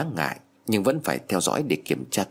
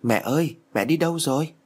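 higher-pitched, mildly anxious call; panicked calling; 6 semitones higher than usual. A narrator's reading. Child wakes up alone and calls for mother, mildly panicked. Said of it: "cuống cuồng ngồi bật dậy rồi gọi".

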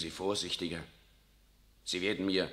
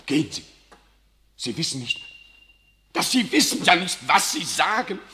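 loud, higher furious command; angry shout; 8 semitones higher, 8 dB louder than usual. Acted. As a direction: drive him out of the room. General orders Riccardo away.

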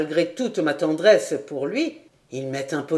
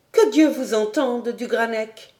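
put-on higher young woman's voice, urgent and pleading; Clara pleading; 5 semitones higher than usual. Clara begging du Granec not to leave, higher pleading voice. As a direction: beg him to stay, voice lifted.

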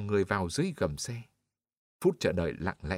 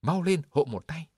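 higher, louder call, short of breath from straining; panting cry; 6 semitones higher, 3 dB louder than usual. A narrator's reading. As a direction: cry out while straining under the weight. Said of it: "tiếng người hồn hển kêu". (kêu hổn hển, giọng cao và to)

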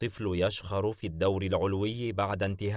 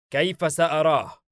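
higher, louder angry demand; furious; 7 semitones higher, 6 dB louder than usual. A narrator's reading. Demon grabbing a comrade's shoulder for answers, furious. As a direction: demand it in exasperation. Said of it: "بيلفيغور بحنق".

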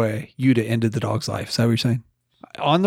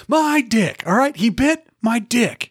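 mock-exasperated outburst, pitched way up; speaking up; 9 semitones higher, slower than usual. Patient told to speak up at the counter, high, exasperated mock outburst. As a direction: pitch it high and drag out the last phrase. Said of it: "You're gonna have to speak up".

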